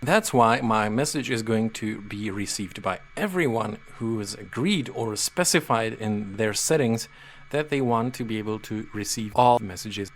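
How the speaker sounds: normal talking voice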